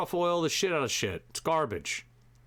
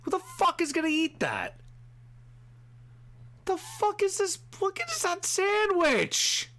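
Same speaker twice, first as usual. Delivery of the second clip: much higher, squeaky voice of disgust; disgusted squeal. Real, not acted. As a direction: recoil at the sandwich in a high squeal. Disgusted at food show sandwich.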